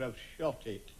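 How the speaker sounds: low, soft deadpan answer; a hint of a glum dog owner; flat low reply. Joke dialogue, other man answers.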